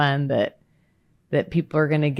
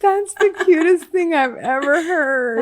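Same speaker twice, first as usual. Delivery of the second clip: delighted gush; 10 semitones higher than usual. Real, not acted. Host delights in guest's childhood story, high and gushing. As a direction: gush over how adorable it is.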